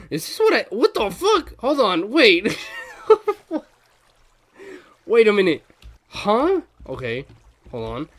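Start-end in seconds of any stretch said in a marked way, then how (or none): 0.1-6.6 high, loud stunned outburst; startled yelling. Real, not acted. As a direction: shout in stunned disbelief. Startled by something in game.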